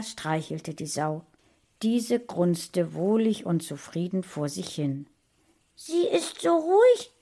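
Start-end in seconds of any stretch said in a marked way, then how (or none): 5.7-7.1 put-on high, wondering child voice; little boy. A narrator's reading. Boy looking at the contented pig, wondering. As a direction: say it as a marvelling small boy.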